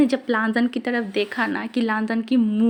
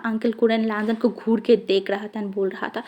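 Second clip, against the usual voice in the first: plain reading voice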